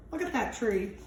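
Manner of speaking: usual speaking voice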